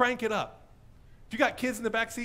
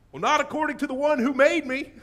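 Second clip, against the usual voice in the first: loud, raised-pitch comeback; defiant retort; 8 semitones higher, 7 dB louder than usual. Acted. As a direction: answer the hushed objector at full voice. Loud retort to the cautious objector.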